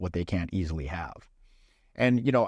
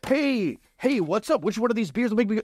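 much higher, rushed, over-keen blurting; overeager student; 12 semitones higher than usual. Retold or imitated. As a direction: burst in like a nervous newbie who ran to the bar. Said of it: "he just runs up, like, right up to the bar".

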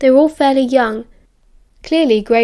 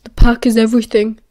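louder, bitter echo of another's motto; bitter quoting; 5 dB louder than usual. A narrator's reading. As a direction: throw “The pack is everything” back, punching “pack”. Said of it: "Remus returned bitterly".

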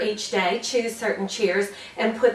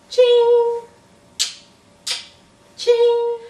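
high, loud bright ching; smiley ching; 14 semitones higher, 6 dB louder than usual. Shown as a demonstration. Teacher demos smiley cheer: high ringing ching. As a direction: sing out a sparkle ching. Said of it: "Let's give ourselves a smiley".

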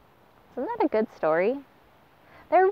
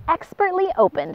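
higher, admiring exclaim; admiring exclamation; 9 semitones higher than usual. Acted. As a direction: exclaim it with delight. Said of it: "exclaimed the pelican".

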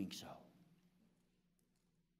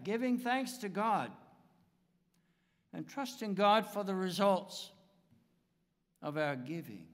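raised, louder pulpit preaching that falls away at phrase ends; 6 semitones higher, 3 dB louder than usual. Acted. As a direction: preach it out to the congregation, then let each phrase drop. Closing sermon, preaching to congregation.

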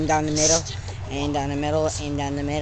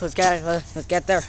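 normal talking voice

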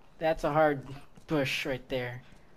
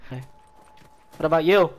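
ordinary delivery

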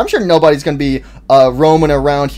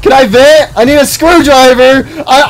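high, loud desperate cries; terrified screaming; 11 semitones higher, 5 dB louder than usual. Real, not acted. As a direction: plead in full panic. Animatronics closing in.

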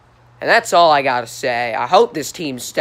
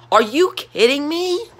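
disbelief; 10 semitones higher than usual. Real, not acted. Fan ranting at his team.

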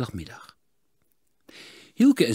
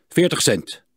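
1 curt; 6 semitones higher, 7 dB louder than usual. A narrator's reading. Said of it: "zei Klinkhamer kortaf".

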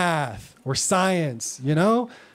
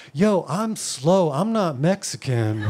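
slow, earnest protest; young [name] slowly insisting to the proctor; slow insistent; slower than usual. Retold or imitated. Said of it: "I'm like".

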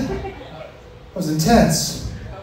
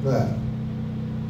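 awed whisper; 3 semitones lower, 7 dB quieter, much slower than usual. Acted. Awed hush.

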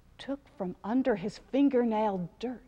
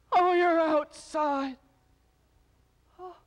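a touch of groaning, higher; 5 semitones higher, 3 dB louder than usual. A narrator's reading. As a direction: goddess and creatures trading a mild groaning lament. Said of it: "She groaned".

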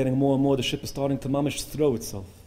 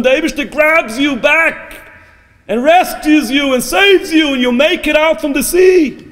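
fervent preaching climax; 13 semitones higher, 12 dB louder than usual. Real, not acted. Preacher promises congregation rescue from the sea, fervent preaching. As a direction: drive the promise home at full force.

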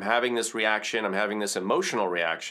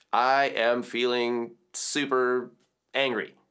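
slowly drawn out, candid; slow honest admission; slower than usual. Shown as a demonstration. Coach showing how to admit anger, slowly. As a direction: spell the feeling out slowly and plainly. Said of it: "but acknowledge it".

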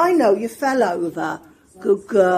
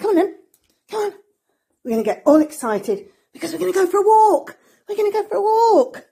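high, louder and fast, hyping the dog; excited pet talk; 9 semitones higher, 4 dB louder, faster than usual. Real, not acted. Getting Poppy excited for her walk, high fast hype. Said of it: "now we're gonna get all excited".

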